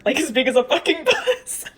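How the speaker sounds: laughing through the insult. Real, not acted. Mocking the fat elder to her viewers, laughing. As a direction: let the laugh break into the words.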